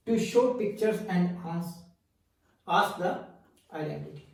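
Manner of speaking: slowed-down, word-by-word reading; deliberate, spaced dictation. Shown as a demonstration. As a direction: read it out slowly for notes. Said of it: "teacher reads out a definition".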